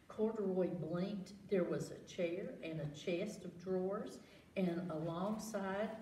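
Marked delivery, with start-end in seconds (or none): none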